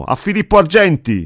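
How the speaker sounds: a loud, high, jeering cry; crowd shout. A narrator's reading. The damned crowd jeering at Filippo Argenti, shouting high. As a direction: yell his name out to mock him. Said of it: "Tutti gridavano".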